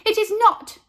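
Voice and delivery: high, loud indignant protest. A narrator's reading. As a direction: snap back hurt. Carla protests hurt.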